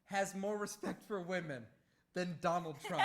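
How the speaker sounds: lightly voiced Trump, fighting a laugh; Trump impression. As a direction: say it with a straight face, barely. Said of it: "You can't even keep a straight face when you're saying that".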